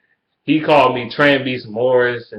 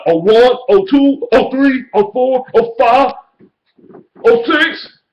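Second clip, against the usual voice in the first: high, loud mock counting of losses; 11 semitones higher, 5 dB louder, slower than usual. Taunting the Bears fan by counting losses in a high mock voice. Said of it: "you're gonna be like".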